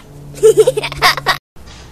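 high, loud burst of laughter. Real, not acted. Laughs at failed take.

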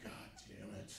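whispered under the breath; soft resigned whispered curse; muttered curse. Retold or imitated. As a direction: curse quietly to yourself. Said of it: "And I'm like".

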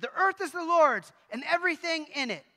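preaching it harder each time. Retold or imitated. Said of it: "read the verse louder and louder".

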